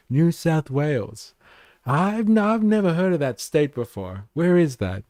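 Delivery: put-on baffled club bouncer; imitated bouncer. Club bouncer puzzling over the ID, mock bafflement. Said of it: "bouncers at clubs and stuff would be like".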